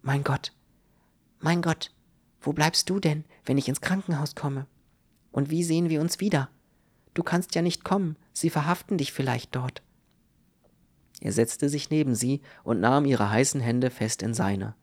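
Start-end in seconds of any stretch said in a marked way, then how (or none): 0.0-9.8 higher, alarmed but restrained woman's voice; startled worry. A narrator's reading. Sick woman fearing for Ludwig's safety, startled worry. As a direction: fret in a lifted voice, mildly frightened. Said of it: "richtete sie sich plötzlich erschrocken auf".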